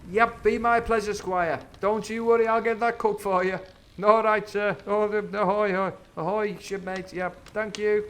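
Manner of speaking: high, loud eager cook voice; servile Silver. Retold or imitated. Silver promising the squire a cake, high and eager. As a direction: grovel cheerfully to the squire. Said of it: "He's like that".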